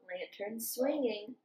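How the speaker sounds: lively sing-song picture book reading; reading to children. A narrator's reading. Teacher reading farm story to children, lively sing-song. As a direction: read it bouncy, like for little kids.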